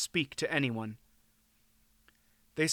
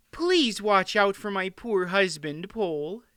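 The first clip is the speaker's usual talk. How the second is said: high, anxious queen's plea; lightly quivering, worried wife; quivering worry; 6 semitones higher than usual. A narrator's reading. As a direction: plead in a higher voice, a touch tremulous. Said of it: "with a little laugh that quivered a bit".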